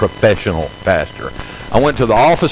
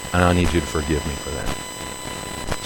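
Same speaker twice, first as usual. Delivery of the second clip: much softer and lower, sincere plea for forgiveness; humble apology; 9 semitones lower, 12 dB quieter than usual. Retold or imitated. Pastor asking the principal's forgiveness, much softer and lower. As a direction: nearly murmur it, laying yourself down.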